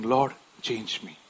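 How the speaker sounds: soft, low confessing prayer; humble prayer. Retold or imitated. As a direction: pray quietly in surrender.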